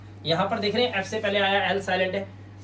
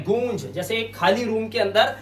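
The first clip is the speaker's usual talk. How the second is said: ordinary delivery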